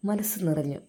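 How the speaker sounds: ordinary delivery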